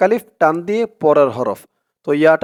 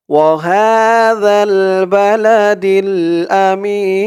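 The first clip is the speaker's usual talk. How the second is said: slow melodic tajweed chant, a bit higher; Quran recitation; 3 semitones higher, 4 dB louder, much slower than usual. Shown as a demonstration. Teacher reciting for viewers to repeat, slow tajweed chant. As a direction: recite it slowly so students can follow. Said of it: "recitation demo".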